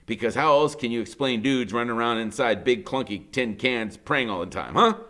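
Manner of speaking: plain reading voice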